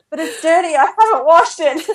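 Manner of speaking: high-pitched, comic pleading; mock whiny protest. Mother protesting to ambulance lady about her floor, mock whiny protest. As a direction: replay a silly objection, pitched right up. Retold whining objection, laughing after.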